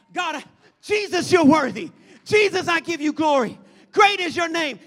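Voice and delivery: loud worship shouts; fervent praise. Acted. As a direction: cry it out to Jesus.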